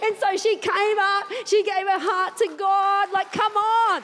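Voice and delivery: high, jubilant proclamation; rally the congregation to celebrate; real exultant preaching climax; triumphant. Real, not acted.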